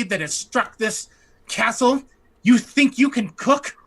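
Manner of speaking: a cook demanding who dares intrude, loud and huffy; indignant cook. Acted.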